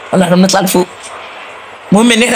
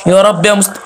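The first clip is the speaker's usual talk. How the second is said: ordinary delivery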